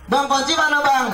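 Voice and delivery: high, sweet call for the keys; a wife calling high for the motorbike keys. Retold or imitated.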